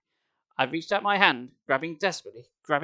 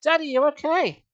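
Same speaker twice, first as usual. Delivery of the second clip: much higher, louder happy cry; joyful relief; 12 semitones higher, 5 dB louder than usual. A narrator's reading. Sari hugging her dazed father, joyful relief. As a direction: gush with relief.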